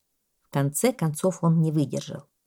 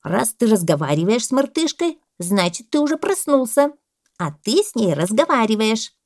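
higher, louder insistent parrot; arguing parrot; 5 semitones higher, 5 dB louder than usual. A narrator's reading. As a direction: press the point as the parrot. Parrot argues with the boa. (попугай, напористо и громко)

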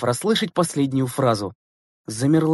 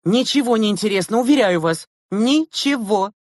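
high, emphatic, last word stretched; exasperated insistence; 8 semitones higher, 3 dB louder than usual. A narrator's reading. Teacher contradicting the kindly driver, exasperated. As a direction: insist there is no hope.

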